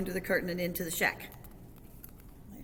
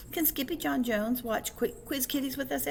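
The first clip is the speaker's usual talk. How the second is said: put-on higher, pleading child-like question; little kitten girl; 7 semitones higher than usual. A narrator's reading. Little sister asks mama to let kitten watch TV, high girlish voice.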